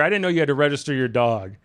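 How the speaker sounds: usual speaking voice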